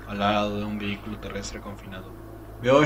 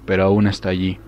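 plain reading voice

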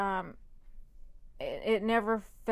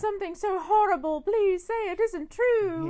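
high, loud mid-Atlantic lady; mid-Atlantic accent; 12 semitones higher, 4 dB louder than usual. Retold or imitated. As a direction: gasp it out like a 1930s society dame. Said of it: "mid-Atlantic accent".

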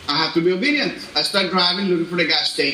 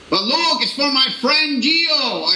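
pleading on phone; 7 semitones higher, slower than usual. Retold or imitated. Retold plea to towing friend.

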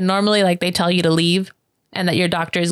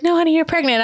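high, lilting happy insistence; retold delighted reassurance; 10 semitones higher, faster than usual. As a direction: imitate someone gladly breaking good news. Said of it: "laughing and smiling".

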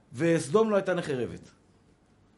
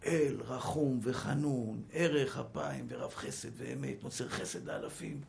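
hushed prayer; 5 semitones lower, 9 dB quieter, slower than usual. Retold or imitated. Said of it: "מה משה רבנו אמר".

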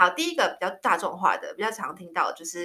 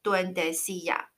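duendecilla spelled out slowly, syllable by syllable; slow teaching demo; slow and clear; slower than usual. Shown as a demonstration. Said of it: "他都叫我".

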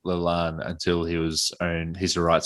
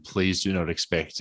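ordinary delivery

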